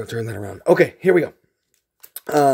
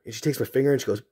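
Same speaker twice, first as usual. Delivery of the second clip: ordinary talk, nothing marked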